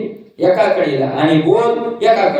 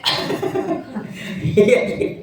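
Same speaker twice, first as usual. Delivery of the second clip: soft breathy chuckle; short quiet laugh; 3 semitones higher, 6 dB quieter than usual. Real, not acted. Audience quip draws his laugh.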